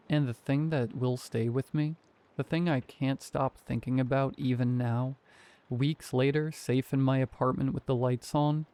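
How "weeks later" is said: ordinary delivery, nothing marked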